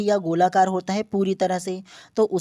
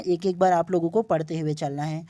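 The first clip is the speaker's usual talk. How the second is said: ordinary delivery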